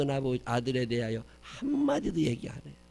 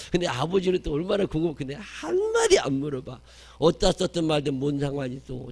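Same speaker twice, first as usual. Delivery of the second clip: rising emphatic preaching; 4 semitones higher, 6 dB louder than usual. Acted. Preacher marvelling that the father asks nothing, rising and loud. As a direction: climb higher and louder, marvelling.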